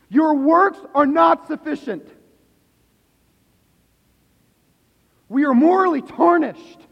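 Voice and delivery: full-force revival preaching. Acted. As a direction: thunder it from the pulpit.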